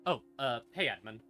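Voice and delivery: usual speaking voice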